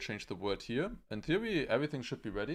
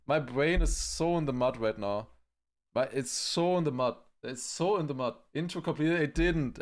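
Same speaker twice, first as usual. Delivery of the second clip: usual speaking voice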